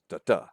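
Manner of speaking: DA-DA as weak-strong beats; light first DA, stressed second in DA-DA; iambic stress. Shown as a demonstration. Lecturer tapping out the iamb, stress on second beat. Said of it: "followed by a stressed one".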